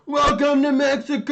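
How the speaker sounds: high, loud excited greeting; wife's gleeful welcome, mimicked by husband. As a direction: announce it like a party host. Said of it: "And then she's like".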